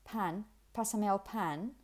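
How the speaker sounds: imitated British or American accent in Spanish; Spanish with English aspirated p's; English speaker's accent in Spanish. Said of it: "If you wanted to imitate".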